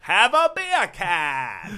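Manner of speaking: very slow, loud Australian drawl; slow mock Australian. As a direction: stretch every word like a mock Aussie. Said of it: "very slow and deliberate".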